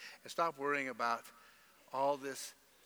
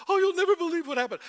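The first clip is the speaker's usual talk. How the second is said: much higher, louder gossip; gossiping drama voice; 14 semitones higher, 12 dB louder, faster than usual. Retold or imitated. Pastor mimicking people rehearsing hurts, high gushing gossip. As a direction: gush it like a breathless gossip. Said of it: "We begin to tell people".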